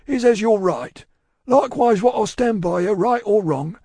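high, loud character voice; earnest Cockney servant. A narrator's reading. Cruncher giving Miss Pross his opinion, earnest. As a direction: speak up as a plain-spoken servant.

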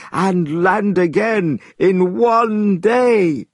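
the immortal. A narrator's reading. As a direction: speak as the patient old master, slowly.

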